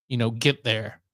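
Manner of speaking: usual speaking voice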